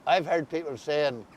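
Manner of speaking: usual speaking voice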